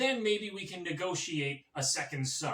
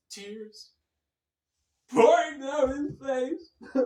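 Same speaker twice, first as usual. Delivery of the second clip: mock petulant litigant, lurching from loud shouts to hushed asides; Donald Trump; 3 semitones higher, 4 dB louder than usual. Comic as former president demanding appeals, blustering then hushed. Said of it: "this guy will literally sue anything".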